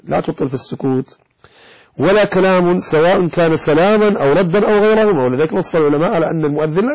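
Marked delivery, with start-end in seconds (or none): none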